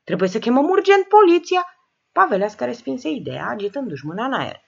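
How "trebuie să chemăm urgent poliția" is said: higher, urgent alarmed voice. Acted. Sile urges calling the police, alarmed and high. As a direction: push to call the police in a panic. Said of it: "început să se alarmeze".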